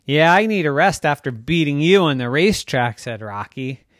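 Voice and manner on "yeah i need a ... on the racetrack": loud, higher, quick comeback; taunting retort. Acted. Rocky taunts his neighbor about the racetrack, boastful. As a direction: brag back loudly at a rival.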